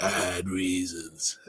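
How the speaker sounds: a lightly voiced, curt dad, soft and low; imitating the terse dad. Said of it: "He's like".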